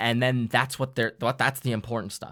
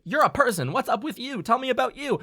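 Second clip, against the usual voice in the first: high, eager questioning; friendly asking; 12 semitones higher than usual. Retold or imitated. Character asks the robot about itself, eager. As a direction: lean in with a curious, pitched-up question.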